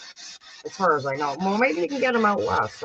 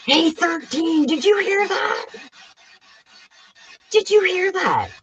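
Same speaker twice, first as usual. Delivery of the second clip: high, loud, startled call to her friend; 11 semitones higher, 3 dB louder than usual. Real, not acted. Streamer hears a voice on the box and startled calls out to Thirteen. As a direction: blurt it out, shocked by the voice.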